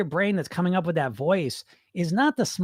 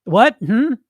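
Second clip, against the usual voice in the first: loud, higher mock surprise; challenging coach voice; 6 semitones higher, 7 dB louder than usual. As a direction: dare it to repeat itself. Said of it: "I want that voice".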